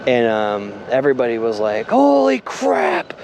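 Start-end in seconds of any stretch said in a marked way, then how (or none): 1.9-3.1 drawn-out, high-pitched mock shock; shocked exclamation. Crew as the truck derails, high drawn-out shock. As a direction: act out stunned alarm, stretching it out slowly.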